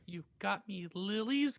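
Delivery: ordinary talk, nothing marked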